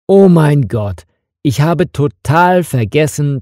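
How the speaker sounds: ordinary delivery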